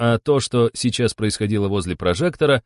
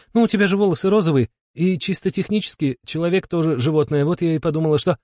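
high-pitched, hurried explaining voice; defensive Yulia; 7 semitones higher than usual. A narrator's reading. Yulia explaining her odd guess to Shama, defensive. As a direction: justify yourself in a high voice.

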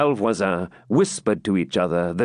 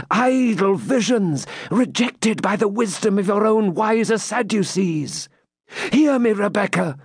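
a knight scorning her beliefs; Templar; 10 semitones higher than usual. Acted. Templar scorning Rebecca's dreams, lightly voiced and proud.

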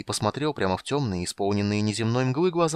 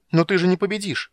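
high, loud, hasty outburst; blurted out; 11 semitones higher, 8 dB louder than usual. A narrator's reading. Kender blurts out his objection to the goddess, high and loud. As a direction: blurt it out before thinking. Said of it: "выпалил Тасс".

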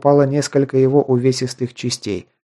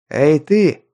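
shout; 3 semitones higher, 3 dB louder than usual. A narrator's reading. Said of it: "крикнул".

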